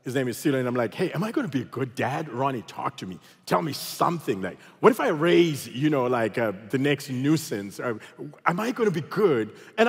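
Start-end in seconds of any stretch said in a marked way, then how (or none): none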